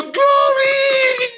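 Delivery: high loud shout of joy; hammed-up praise cry; high loud mock celebration; mock glory shout. Mocking joyful husband reaction.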